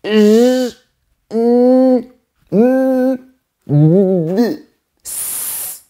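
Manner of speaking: consonants «J, n, m, d» alone without vowel; demonstrating impossible vowelless words; demo of bare consonants. Shown as a demonstration. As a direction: sound the letters with no E. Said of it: "qu'allons-nous dire?".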